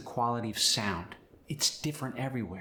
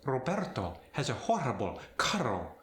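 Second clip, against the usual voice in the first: Spanish accent. Shown as a demonstration. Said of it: "A more aggressive rolled R".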